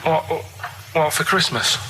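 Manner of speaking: normal talking voice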